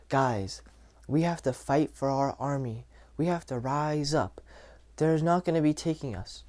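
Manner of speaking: soft, weak rallying speech; unconfident. Shown as a demonstration. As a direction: demo a leader with no conviction, voice held back. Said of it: "The only thing that's wrong with what I'm doing is the subcommunications, my tone".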